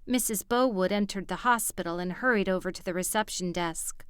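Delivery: plain reading voice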